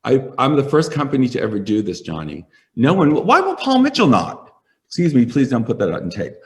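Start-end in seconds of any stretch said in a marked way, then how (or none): 2.8-4.5 indignant. Real, not acted. Indignant rant about rival brands.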